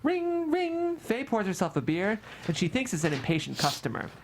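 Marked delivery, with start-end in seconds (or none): 0.0-1.0 high, sung ring-ring; ringing bell imitation. A narrator's reading. Narrator imitates the office bell, high singsong. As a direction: chirp it like a bell.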